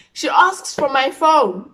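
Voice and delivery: loud, high, drawn-out wail; dramatic outcry. Acted. Vlogger revealing mom wants the phone, dramatic outcry. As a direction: cry it out slowly in alarm.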